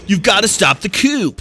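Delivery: "coup" said as koop with a P sound. Retold or imitated. Gamer mocking the voice actor's "coup" mispronunciation. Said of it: "the voice actor pronounces the P".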